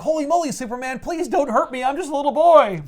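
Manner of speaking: high-pitched mock little-boy plea; pleading little boy. Billy begs Superman not to hurt him, high mock pleading. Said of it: "reverts back to Billy Batson".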